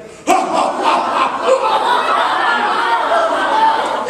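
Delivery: long, very high rising villain cackle; an over-the-top evil pirate cackle; horrid pirate laugh. Acted. Dirty Joe boarding a ship, horrid rising cackle. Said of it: "and with a horrid laugh".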